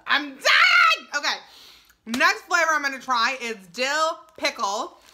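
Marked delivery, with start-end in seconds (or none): 0.1-1.1 loud, very high squeal; excited shout. Real, not acted. Reviewer overwhelmed by ranch popcorn, excited shout. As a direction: shriek it out in overjoyed disbelief. Overwhelmed by how good it tastes.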